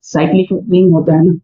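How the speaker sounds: ordinary talk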